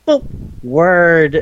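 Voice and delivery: very high sputtered babble before the word; speech impediment guy. Retold or imitated. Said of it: "speech impediment guy goes".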